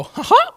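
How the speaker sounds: very high, loud cartoon chuckle; skipping falsetto mouse laugh; Mickey Mouse laugh. Shown as a demonstration. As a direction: laugh high with a little skip. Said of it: "my laugh sounds a little different".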